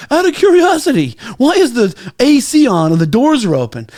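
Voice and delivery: high, falsely polite mock boss voice; building owner questions the doorman, mock polite; snooty boss. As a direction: ask it with fake sweetness. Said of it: "He goes".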